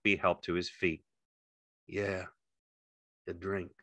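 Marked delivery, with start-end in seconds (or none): 1.9-3.7 soft, drained echo; subdued. A narrator's reading. George agreeing to Slim's drink, quiet and subdued. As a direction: repeat it softly, worn out. George numb after the shot.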